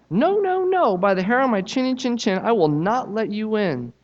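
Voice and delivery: high, squeaky pig refusing; the little pig digs in, high; defiant little pig. Acted. Little pig refusing the wolf, high defiant voice.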